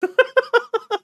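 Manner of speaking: a sudden loud burst of real laughter, pitched high. Real, not acted. Streamer cracking up at the hammer scene, loud laugh.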